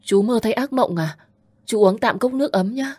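put-on gentle caring young woman; compassionate. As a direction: comfort a shaken uncle. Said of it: "giọng quan tâm".

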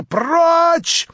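high, very loud cry of rage; furious scream. A narrator's reading. Natasha screaming at the prince to get out. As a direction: hurl the word at him. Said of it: "закричала Наташа".

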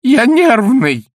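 high, loud, desperate begging; Panikovsky wailing to Ostap not to throw him out; pleading. A narrator's reading. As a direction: beg for mercy at the top of your voice. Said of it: "взмолился Паниковский".

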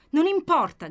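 loud, high defiant shout. Acted. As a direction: hurl it back at him. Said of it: "gridò lei".

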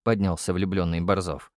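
ordinary delivery

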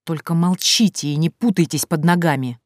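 usual speaking voice

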